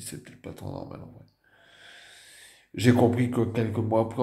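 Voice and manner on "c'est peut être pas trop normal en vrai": quiet inner thought. Retold or imitated.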